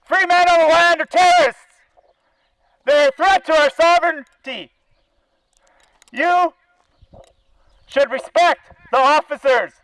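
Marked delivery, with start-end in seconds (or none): none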